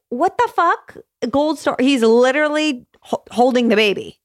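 high-pitched, incredulous outburst; indignant disbelief. Real, not acted. Mother objecting to gold-star praise for dad, indignant.

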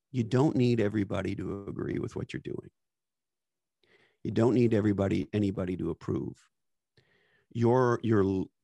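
ordinary talk, nothing marked

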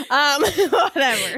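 laughing it off. Real, not acted. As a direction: giggle breaking through, slow. Giggling over a crude joke.

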